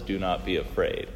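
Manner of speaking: low and soft, calm reassurance; hushed divine reassurance; gentle voice of God. Acted. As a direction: speak God's words softly, like a comfort. Quoting God's words gently.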